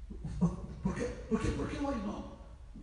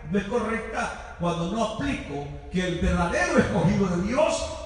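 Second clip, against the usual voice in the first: building, louder fervent sermon climax; preaching at full force; 4 semitones higher, 6 dB louder than usual. Acted. Pastor building to a loud climax for the church, fervent preaching. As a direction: drive the point home to the congregation.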